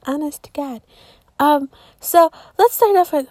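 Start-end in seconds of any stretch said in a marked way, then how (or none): 0.1-0.8 soft repeat. Real, not acted. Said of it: "I don't know what the fuck my life is".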